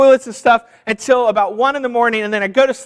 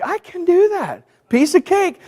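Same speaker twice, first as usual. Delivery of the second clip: higher, overconfident "piece of cake"; cocky self-mimic; 8 semitones higher than usual. Pastor mocking his own overconfidence to church, breezy and high. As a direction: brush it off like it's nothing. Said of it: "I'm like".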